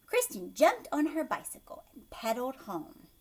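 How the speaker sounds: plain reading voice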